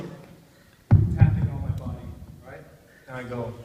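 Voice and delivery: ordinary talk, nothing marked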